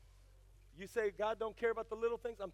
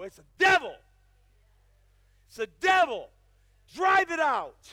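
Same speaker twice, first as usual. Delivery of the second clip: shouted, higher and much louder rebuke; fiery preaching shout; 9 semitones higher, 15 dB louder than usual. Real, not acted. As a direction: cast it out with your whole voice.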